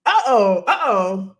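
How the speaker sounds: low, loud teasing uh-oh; a playful warning, drawn low; host teasing a confident viewer, low mock warning. Real, not acted. Reacting to "about to win.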